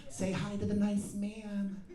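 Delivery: high, slow, sing-song mom voice; mock sweet mom. Mom talks to her baby over the seat, slow sing-song.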